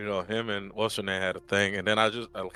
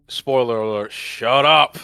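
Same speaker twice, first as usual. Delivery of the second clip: loud shut up; 3 semitones higher, 8 dB louder, slower than usual. Retold or imitated. Fed-up viewer shouting shut up at the screen. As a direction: bark it at the screen.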